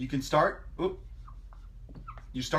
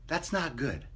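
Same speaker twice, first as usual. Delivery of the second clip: softer, muttered again; quiet repeat; 7 dB quieter than usual. Shown as a demonstration. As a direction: drop the volume on it.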